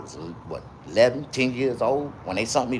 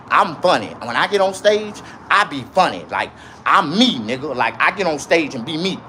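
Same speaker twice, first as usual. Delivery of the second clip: normal talking voice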